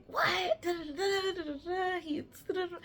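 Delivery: high squealing friends' chatter; excited gossip. Retold or imitated. Friends gagging over the encounter, high excited squeals. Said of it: "They're like".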